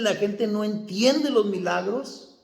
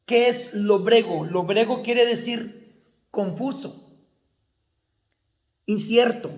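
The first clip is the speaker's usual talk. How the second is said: ordinary talk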